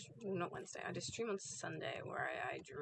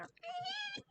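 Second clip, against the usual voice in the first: high, sharp yelping fox noise; 4 dB louder than usual. Retold or imitated. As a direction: yip like a fox when hit. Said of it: "a surprisingly good impression".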